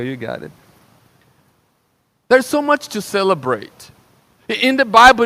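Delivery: normal talking voice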